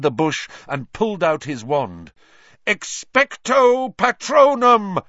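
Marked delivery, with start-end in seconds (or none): 2.7-5.0 loud, high, drawn-out spell cry; ringing high shout, each word stretched; yelled incantation. A narrator's reading. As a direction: yell the spell slowly at full force. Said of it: "he yelled".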